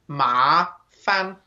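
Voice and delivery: rising "ma", then light "fan"; spaced out, "ma" lifting, "fan" flicked lightly; tone demonstration. Shown as a demonstration. Teacher showing pronunciation, "ma" rising and "fan" light. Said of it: "that's how we pronounce it".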